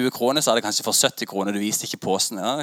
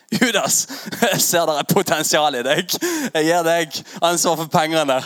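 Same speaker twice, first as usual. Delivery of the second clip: higher, louder, slow mock-solemn Jesus; hammed-up Jesus; 5 semitones higher, 3 dB louder than usual. Jesus handing Judas the money box, hammed-up solemn voice. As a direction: bestow the job with mock gravity, laughing.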